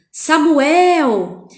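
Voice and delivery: put-on a loud, drawn-out call of the boy's name; the voice of God calling in the night. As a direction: call Samuel from far away, loud and long. The voice calls again.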